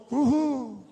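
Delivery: a hinted woo-hoo; light mock celebration; mock cheer. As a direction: cheer for your own success. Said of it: "and go".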